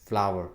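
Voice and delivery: "flour" said flau-er, like flower; correct flour. Shown as a demonstration. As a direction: say "flour" as flower, not floor. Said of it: "this is not floor". ("flour" को फ्लावर जैसा बोलें)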